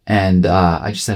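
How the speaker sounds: ordinary delivery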